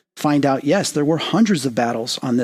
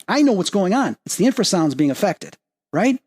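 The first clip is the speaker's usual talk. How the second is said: normal talking voice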